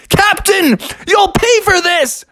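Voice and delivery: put-on high, indignant kid yell; Froggy. Boy yelling at the cereal box, indignant. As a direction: holler a threat in a kid voice. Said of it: "Froggy was yelling at his cereal box".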